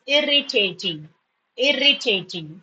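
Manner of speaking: four measured beats on "irritating"; syllable by syllable. Shown as a demonstration. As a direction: break "irritating" into clear syllables.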